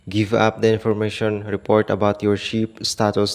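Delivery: ordinary talk, nothing marked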